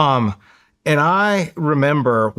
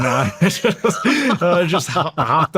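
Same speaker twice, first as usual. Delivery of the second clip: laughing; 6 semitones higher, slower than usual. Real, not acted. Laughs recalling punishment.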